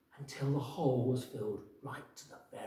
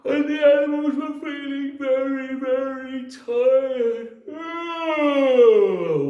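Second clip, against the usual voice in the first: weary sigh; 12 semitones higher, 15 dB louder, slower than usual. A narrator's reading. Tired animals.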